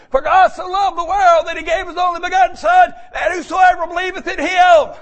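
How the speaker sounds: high, loud scripture cry; preaching shout. Real, not acted. Preacher proclaims John 3:16 to the church in a loud high shout. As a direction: cry the verse out at full force.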